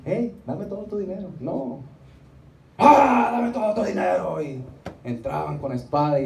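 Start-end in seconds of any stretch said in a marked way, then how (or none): 0.1-1.3 soft, higher-pitched half-hearted demand; meek mock robber. Shown as a demonstration. Preacher showing how raiders did not enter, meek mock demand. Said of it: "¿Y usted cree que entraban así?". 2.8-4.4 loud, high attack cry, falling away; shouting mock raider. Shown as a demonstration. Preacher showing how raiders stormed a city, shouted mock demand. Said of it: "¿Y usted cree que entraban así?".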